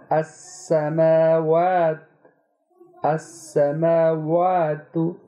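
अस्समावातु slow, with a thin, light aa; fine Quranic alif. Shown as a demonstration. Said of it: "बारीक पढ़ रहे हैं". (बारीक अलिफ से अस्समावातु)

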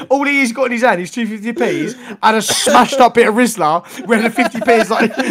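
words squeezed out through real laughter; laughing while talking. Real, not acted. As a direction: tell it while cracking up, high and loud. Cracking up at his own story.

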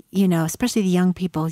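ordinary talk, nothing marked

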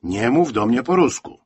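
loud irritated cry; angry shout. A narrator's reading. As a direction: shout it as a sharp order. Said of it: "krzyknął Franek".

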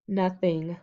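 ordinary delivery

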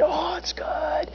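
slow, hushed savouring; drawn-out, soft food rapture. Pretend. Picturing burgers for the congregation, savouring softly.

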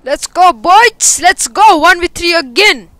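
loud, high hype yells; excited shouting. Real, not acted. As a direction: shout it out like a clutch win. Gamer hyped in a clutch.

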